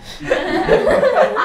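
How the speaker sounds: burst of laughter. Acted.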